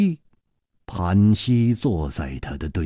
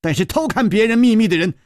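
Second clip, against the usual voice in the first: sharp, higher bursts of a fierce confession; stern outburst; 6 semitones higher than usual. A narrator's reading. Gong Nanyan admits the killing and threatens Chu Liuxiang, fierce. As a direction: bite out the threat, rising as it goes. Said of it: "宫南雁忽然厉声说".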